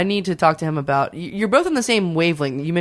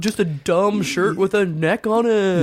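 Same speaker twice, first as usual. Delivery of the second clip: mocking voice; slower than usual. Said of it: "mocking it as in".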